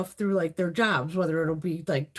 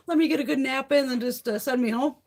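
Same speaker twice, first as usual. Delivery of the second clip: higher, sarcastic mock plan to sleep at the hospital; 6 semitones higher than usual. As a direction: lay on the sarcasm, faster. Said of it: "I just figured".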